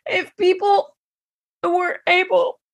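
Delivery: choked up, voice catching; words squeezed by tears; strangled voice. Real, not acted. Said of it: "I'm choked up".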